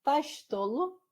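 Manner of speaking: demonstrating a mashed-up Brazilian regional accent; mixed regional dialect. Shown as a demonstration. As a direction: blend Bahian "oxe" with Southern slang. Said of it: "que eu usava assim".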